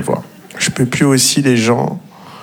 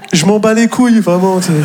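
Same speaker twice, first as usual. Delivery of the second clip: exasperated outburst; 6 semitones higher than usual. Real, not acted. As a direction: blurt out being fed up. (ras-le-bol, plus fort et plus aigu)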